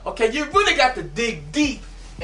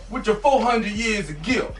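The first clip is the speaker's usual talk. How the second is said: ordinary delivery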